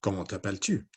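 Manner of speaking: understated puppet character, no squeak; Matisse puppet voice. Acted. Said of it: "Matisse and Inez".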